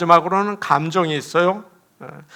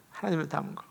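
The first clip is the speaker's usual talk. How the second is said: 하나님을 닮은 거 repeated much softer, almost to herself; soft echo; 15 dB quieter, much faster than usual. Shown as a demonstration. Pastor echoing her own point softly to the congregation. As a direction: let the repeat fall away into a murmur. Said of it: "repeats the phrase quietly".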